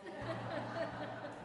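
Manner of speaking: a soft, high laugh at the dodge; short laugh. Real, not acted. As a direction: laugh quietly at the cliché.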